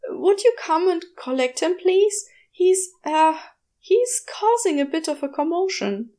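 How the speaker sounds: a flustered shopkeeper calling a customer; young shop woman. A narrator's reading. Shop girl Kathy phones Aziraphale about his snake, hesitant.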